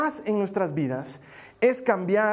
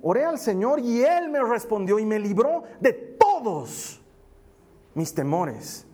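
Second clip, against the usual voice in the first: emphatic scripture reading; 2 semitones higher, slower than usual. Real, not acted. Pastor rereading the psalm to the congregation, emphatic and slow. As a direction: proclaim it, punching TODOS, then drop on "mis temores". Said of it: "Te lo quiero leer otra vez".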